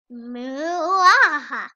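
loud hammed-up evil laugh; sneering panto villain. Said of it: "Here's a better one".

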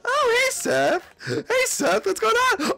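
very high put-on sweet hello; mock-friendly squeaky greeting; teasing greeting. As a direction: greet a rival in a silly high voice. Teasing greeting to found opponent.

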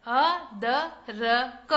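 अदरक drawn out very slowly, each syllable separate: अ-द-र-क. Shown as a demonstration. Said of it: "कौन कौन से अक्षर से मिलकर बना है".

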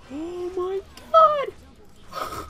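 high, slow stretched cry; drawn-out exclamation. Real, not acted. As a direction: stretch the words out in shock.

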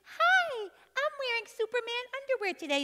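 put-on high, proud small-child announcement; high-pitched little boy; little kid's voice. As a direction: show off like a little boy, loud high hello first. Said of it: "looks up at me and it says".

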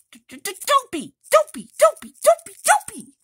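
high, loud, fast shriek of the name; excited shrieking chant. Acted. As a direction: squeal the name at the top of your voice.

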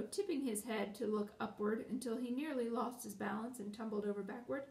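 ordinary delivery

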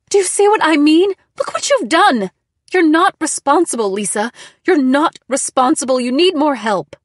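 loud, high, berating tirade; angry scolding. A narrator's reading. Mom berating daughter over the broken lamp, angry shouting. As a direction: berate her, hammering each accusation.

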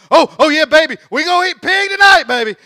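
hammed-up hunter crowing over dinner; exultant mock hollering. Hunter tells his wife about pig for supper, exultant mock holler. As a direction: whoop it up, high and very loud.

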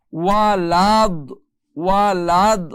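ওয়ালাদ loud and slow, লা leaning into a held heavy দ; joined consonants. Shown as a demonstration. Teacher showing lam joining doad, ওয়ালাদ loud and measured. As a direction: catch the দ firmly at the end of ওয়ালাদ. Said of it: "লামটা আসে দোয়াতকে ধরবে".

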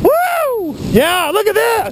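high, loud slow cheer; excited whoop. Real, not acted. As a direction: whoop with delight. Thrilled riding cheers.

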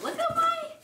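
very high, loud squeal of delight; gushing over the new iPad; excited squeal. Real, not acted.

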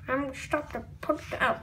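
ordinary delivery, nothing marked

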